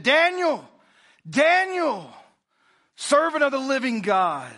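high, loud anguished calling out; troubled cry. A narrator's reading. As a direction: call for Daniel in desperate fear. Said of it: "he cried out with a troubled voice".

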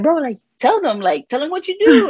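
high, laughing push to tell them; egging her on through laughter; laughing boss urging. Retold or imitated. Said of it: "And they're like".

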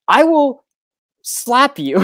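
high, loud joking threat; mock threat. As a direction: playfully threaten a slap.

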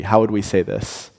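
ordinary delivery

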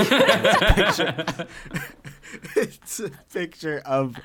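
laughing. Real, not acted. Laughing while describing image.

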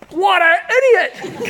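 high, loud, slow exclaim with a laugh; mock road rage. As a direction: blurt it out in mock anger. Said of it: "comes out".

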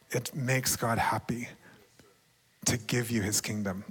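hushed, breathy whisper; soft awed whisper. Real, not acted. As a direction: whisper it like a secret treasure. Repeated key phrase, whispered.

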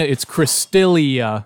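Cristelia drawn out slowly; stress on Cristelia. Shown as a demonstration. Correcting the name from Crystal and Christina, Cristelia slowly emphasized. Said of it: "It's not Crystal, it's not Christina".

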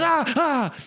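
two high, loud yelps; imitated cries of a man in an armlock; mock pain yelps. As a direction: yelp as if your elbow is cranked. Pinned man yelps under elbow pressure.

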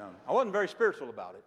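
normal talking voice

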